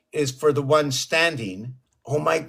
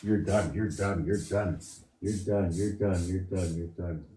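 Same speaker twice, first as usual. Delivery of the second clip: soft murmur; 6 semitones lower, 10 dB quieter than usual. Real, not acted. Soothing the cat, winding down.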